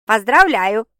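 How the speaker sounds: loud, merry congratulation; cheerful. A narrator's reading. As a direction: say it brightly and loudly. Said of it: "весело произнесла сестра". (весело и громко)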